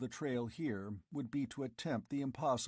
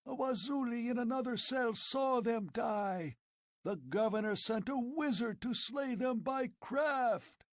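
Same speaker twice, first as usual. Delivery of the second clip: screeched; 13 semitones higher than usual. Acted. Old chief screeching at Conan, enraged. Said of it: "he screeched".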